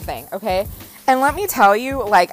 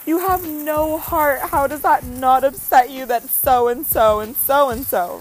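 much higher, slow, theatrical upset; hammed-up wounded outrage; 5 semitones higher, 4 dB louder, slower than usual. Wife scolding husband for having no heart, high slow whine. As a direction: whine it like a betrayed drama queen. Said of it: "And I'm like".

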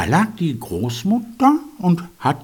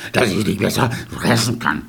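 wolf voice; 6 semitones lower than usual. A narrator's reading. Wolf answers the girl.

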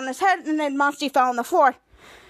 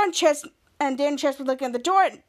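usual speaking voice

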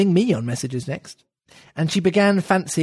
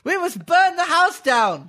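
much higher, slow, decisive voice; high, slow mock-stern rabbit; Rabbit's voice; 11 semitones higher, 3 dB louder, slower than usual. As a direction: declare it like a fussy rabbit. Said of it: "said the rabbit's voice".

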